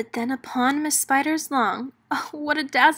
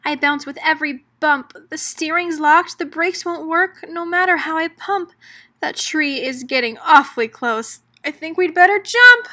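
higher and louder, building to a cry; panicking; 5 semitones higher, 7 dB louder than usual. Acted. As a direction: panic as the tree gets close. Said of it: "Miss Spider moaned".

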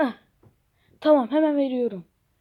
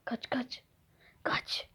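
hushed urging; 14 dB quieter, slower than usual. Pretend. A kid hissing at his buddy to flee, hushed. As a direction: keep it down, tell them to run.

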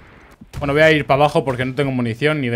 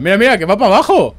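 louder, higher, thrilled to watch it fall; excited; 6 semitones higher, 6 dB louder than usual. Real, not acted. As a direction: cheer the tank tipping over. Watching tank roll down. (emocionado y exaltado)

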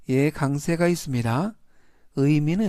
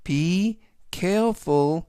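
Be careful read very slowly; drawn out for learners; slow model phrase; much slower than usual. Shown as a demonstration. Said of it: "실용예문 보시겠습니다". (천천히 읽는 예문 구절)

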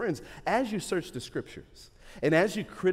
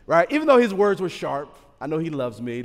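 usual speaking voice

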